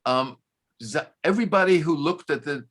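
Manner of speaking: ordinary talk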